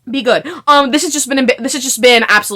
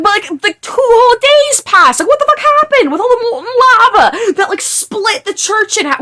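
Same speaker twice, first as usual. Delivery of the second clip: incredulous laughing rant; 12 semitones higher than usual. Real, not acted. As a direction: laugh through the outrage, pitched way up. Incredulous rant about the time jump.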